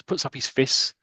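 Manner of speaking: ordinary delivery, nothing marked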